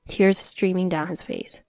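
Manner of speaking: usual speaking voice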